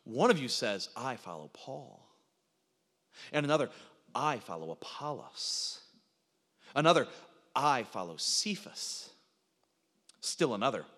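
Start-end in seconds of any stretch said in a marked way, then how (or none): 1.0-2.1 slow, low, soft declaration; mock-pious faction member. Corinthian boasting of a favourite leader, mock-pious. Voicing rival church factions. 4.1-5.8 slow, lowered, drawn-out claim; mock-pious faction member. Corinthian claiming a rival leader, mock-pious. 7.5-9.1 slow, lofty, stretched-out words; mock-pious faction member. Another Corinthian naming a rival leader, mock-pious.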